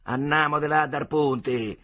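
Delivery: slow, firm command; bossy order. Acted. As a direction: stare him down and decide. Bully takes the oars. (ordine prepotente)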